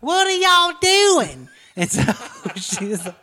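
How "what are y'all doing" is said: very high, loud, slow Texan twang on "What are y'all doing?"; thick Texas twang. Retold or imitated. Friend mimics Pooter's bad Texan accent, high and slow. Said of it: "she has the worst accent of all".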